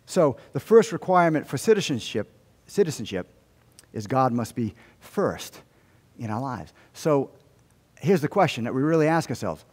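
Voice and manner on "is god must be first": usual speaking voice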